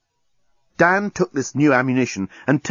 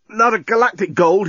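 journalist's voice; 8 semitones higher, 3 dB louder than usual. A narrator's reading. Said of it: "he said".

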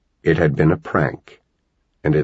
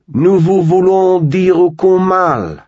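much higher, louder, slow French call; yelled French greeting; 13 semitones higher, 6 dB louder, slower than usual. A narrator's reading. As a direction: announce peace grandly in French. Boy yells French greeting to aliens.

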